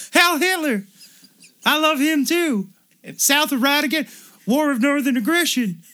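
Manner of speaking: very high, loud cartoon voice; Dudley impression. Brother praising the South as Dudley, very high cartoon voice. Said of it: "Do I even sound like Dudley anymore".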